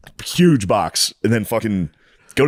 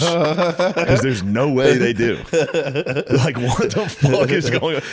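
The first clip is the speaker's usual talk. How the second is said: higher-pitched, slow incredulous laughter; laughing disbelief; 5 semitones higher, much slower than usual. Real, not acted. Friend tells of doubting the grocery store does inspections, laughing incredulously. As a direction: laugh through the words, baffled.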